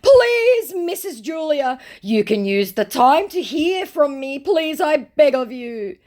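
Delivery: very high wailing plea; overdone, fake-crying supplication; mock tearful begging. Scammer begs her to hear him out, mock wailing. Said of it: "put in a crying emoji".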